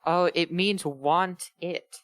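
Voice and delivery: ordinary talk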